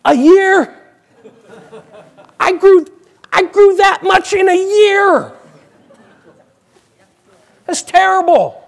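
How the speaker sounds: put-on high, loud, outraged child's voice; disappointed little boy. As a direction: wail like a kid who barely grew. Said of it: "I go".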